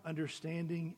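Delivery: ordinary talk, nothing marked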